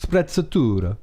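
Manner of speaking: Sprezzatura in a put-on Italian accent. Shown as a demonstration. Host shows off the Italian word, in a put-on Italian accent. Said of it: "You gotta do an accent and say it right".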